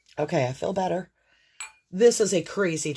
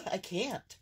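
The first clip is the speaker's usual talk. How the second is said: out of breath; 2 semitones higher, 10 dB quieter than usual. Real, not acted. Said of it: "Just gonna catch my breath".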